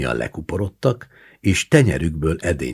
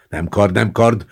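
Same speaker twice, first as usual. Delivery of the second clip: moderately loud, higher mock yell; 5 semitones higher, 6 dB louder than usual. A narrator's reading. Gendarme running with his sword, yelling. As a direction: yell it repeatedly, lightly acted. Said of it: "ordítozott a csendőr futás közben".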